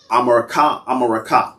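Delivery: America said as "ah-ma-ra-KAH"; original Mayan pronunciation. Shown as a demonstration. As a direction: say America with open ah vowels, ending on KAH. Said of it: "how do you pronounce that?".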